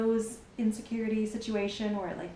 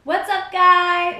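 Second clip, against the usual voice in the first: big, slow, loud hello, much higher; loud greeting; 9 semitones higher, 13 dB louder, slower than usual. Real, not acted. Vlogger opens the video to viewers, loud slow greeting.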